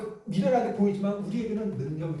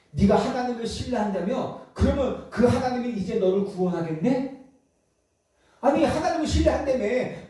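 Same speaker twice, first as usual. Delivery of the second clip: raised-pitch, sarcastic challenge; mocking; 5 semitones higher than usual. Preacher voices scoffers waiting to see God rescue Jesus, mocking. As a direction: needle him about his trust in God. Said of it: "이렇게 조롱을 하는 거예요".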